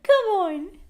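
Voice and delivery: high, loud sing-out to a pet; coaxing dog call. Retold or imitated. Owner getting her dog to listen, high loud coaxing. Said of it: "listen to me, like".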